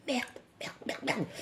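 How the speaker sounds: hushed, toneless merde; whispered cursing. Retold or imitated. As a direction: swear it under your breath so nobody hears. Cursing under her breath in the shop.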